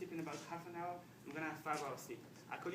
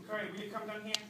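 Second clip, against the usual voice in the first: raised voice, calling up to someone; 5 semitones higher, 6 dB louder than usual. Acted. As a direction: call your wife back down. Husband calls wife back down.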